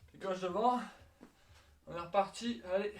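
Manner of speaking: loud workout call; coach command. Real, not acted. Coach calling workout start.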